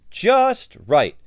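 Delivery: high, loud and pleased; delighted exclamation. A narrator's reading. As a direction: exclaim it with clear satisfaction. Pleased at finding boots that fit.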